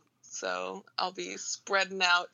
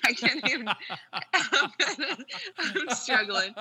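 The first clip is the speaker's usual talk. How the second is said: higher, words breaking into giggles; laughing helplessly; 6 semitones higher than usual. Real, not acted. Guest cracks up at her own accent attempt, laughing helplessly. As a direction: talk through a laughing fit.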